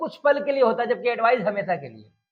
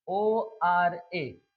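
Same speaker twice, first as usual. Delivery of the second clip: spelling out slowly; 3 semitones lower, much slower than usual. Shown as a demonstration. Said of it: "ओरा".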